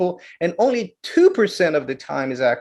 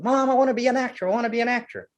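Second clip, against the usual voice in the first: put-on kid begging; 7 semitones higher, much faster than usual. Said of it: "I said".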